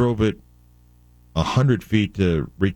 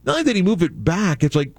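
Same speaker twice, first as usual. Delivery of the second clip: exasperated; 8 semitones higher than usual. Real, not acted. Complaint about mechanic.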